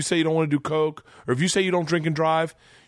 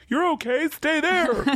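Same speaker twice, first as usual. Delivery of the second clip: mock soothing cop; slower than usual. Mock gentle cop version.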